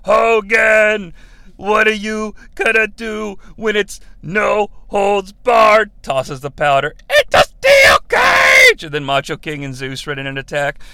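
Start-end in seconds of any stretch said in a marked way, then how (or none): 0.0-5.9 loud, slow, drawn-out taunt; hammed-up challenge shouted word by word; Sherry Martel. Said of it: "she was like". 7.1-8.8 slow, very high screaming shout; hammed-up wrestling promo scream; Sherry Martel. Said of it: "she was like".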